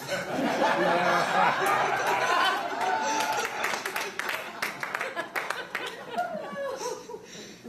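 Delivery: high, helpless fit of laughter that won't stop; helpless long laugh. Real, not acted. Telling the audience about Marie, a long helpless laugh. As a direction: break up laughing before the story can start. Remembers a friend's hidden state.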